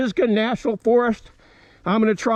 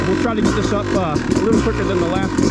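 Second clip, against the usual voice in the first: over engine noise; 4 dB louder than usual. Real, not acted. Talking while riding the dirt bike.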